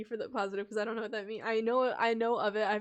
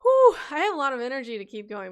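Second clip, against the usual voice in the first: loud burst of energy; fast and loud; energetic; 3 semitones higher, 12 dB louder, faster than usual. Real, not acted. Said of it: "but my voice is starting to die".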